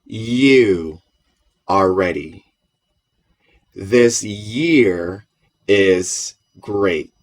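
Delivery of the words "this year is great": "This year is great" very slow, the Y of "year" like an ee glide; slow English Y. Shown as a demonstration. Teacher modelling the English Y for the class, slow and deliberate. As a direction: stretch "year" so its Y sounds like a Latin I.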